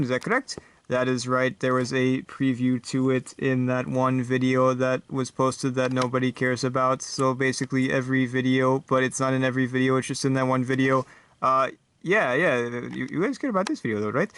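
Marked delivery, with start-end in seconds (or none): 0.9-11.1 robot. Acted. Robot guest answering the host's joke, monotone robot. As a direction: say it like a humourless robot, unvarying pitch. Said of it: "guest star for today is this robot guy".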